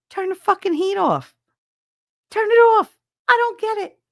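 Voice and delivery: very high, rising to a shout; exasperated outburst. Real, not acted. Ranting at whoever left the heat on, exasperated. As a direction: explode in high-pitched disbelief.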